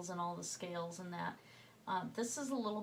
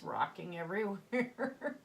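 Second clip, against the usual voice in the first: laughing; 4 dB louder than usual. Real, not acted. Laughing about the rocking camera.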